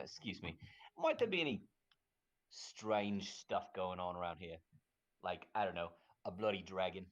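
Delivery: normal talking voice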